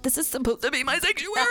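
high, whiny half-crying complaint; hammed-up crying protest; mock whiny wail. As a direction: wail it like a put-upon mom. Mom exasperated by kids in room.

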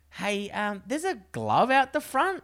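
high, slow drawn-out country bloke voice; mock old local telling you news. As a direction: squeak it out like a chatty old local. Old bloke quoted at dinner.

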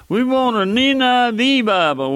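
high, mocking put-on customer asking for a Bible. Preacher mocking bookstore customers, high put-on voice. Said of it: "folks come in here in our bookstore".

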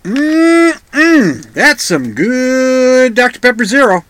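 savoring a drink just sipped; while sipping a drink. Real, not acted. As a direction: talk right after a gulp of soda. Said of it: "I have to take a sip".

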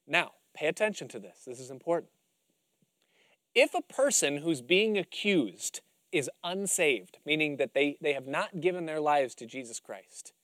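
ordinary talk

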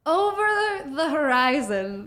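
high, slow, grand pompous narration; theatrical purple-prose voice; mock flowery essay. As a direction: ham up an overwritten essay line.

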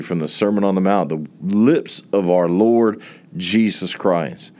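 ordinary talk

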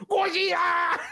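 high mock scream of alarm; hammed-up panicked shout. Demanding his missing film extra, hammed-up panicked yell. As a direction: shout it like a fleeing movie extra. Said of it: "I need the guy going".

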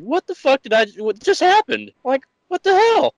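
high-pitched baffled disbelief. Retold or imitated. Retelling how he reacted after the waitress stormed off, high and baffled. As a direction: squeak out total confusion.

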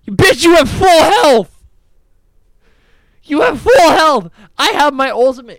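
loud, high exasperated yell; retold yell. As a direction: shout it in disbelief at your teammate. Yelling at fleeing jungler.

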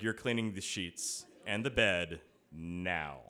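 low, slow, measured command; slow, deliberate ultimatum, pitched low; stern order. Retold or imitated. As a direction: imitate a fed-up fiancée laying down the law. Fiancée's order after dog soils bed.

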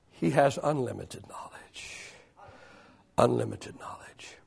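very low, near-whispered; hushed awe. Real, not acted. Preacher contrasting God's knowledge with ours, hushed. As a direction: let it drop to a whisper.

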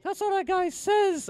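very high, slow cartoonish reply; high puppet voice. Ventriloquist's puppet commenting on the celebrity, high-pitched voice. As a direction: pitch it way up and stretch it.

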